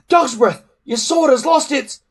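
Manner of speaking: high, loud urgent call; urgent warning. A narrator's reading. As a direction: shout a warning, cut off. Hiccup warns urgently.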